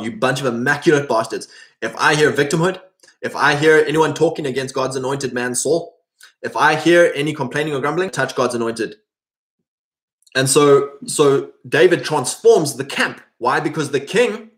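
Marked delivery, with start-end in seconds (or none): none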